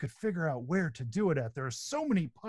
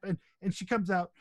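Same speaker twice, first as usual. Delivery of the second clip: ordinary delivery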